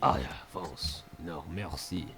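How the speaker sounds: low, soft, slow faux-French voice; bad French accent. Gamer answering France in broken French accent. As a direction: put on a clumsy French accent. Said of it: "my French is so bad".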